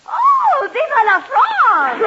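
very high, loud, slow gushing shout with a laugh. Acted. Hostess swooning over the famous singer, gushing shout.

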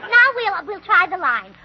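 usual speaking voice

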